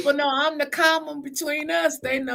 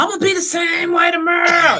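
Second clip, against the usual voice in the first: louder, higher defiant comeback; defiant shout; 5 semitones higher, 6 dB louder, faster than usual. Retold or imitated. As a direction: shout it back, stubborn and playful. Retelling her own reply to Winters.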